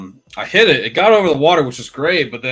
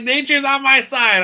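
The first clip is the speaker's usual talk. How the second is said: much higher and louder, triumphant; gleeful boast; 13 semitones higher, 10 dB louder than usual. Retold or imitated. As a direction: crow it like you got lucky. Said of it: "It's like".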